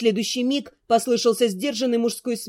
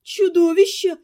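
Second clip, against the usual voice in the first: higher, horrified exclamation; gasped out in fright; 5 semitones higher, 4 dB louder than usual. A narrator's reading. Neighbour bursts in pale with terrible news, frightened gasp. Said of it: "выдохнула та".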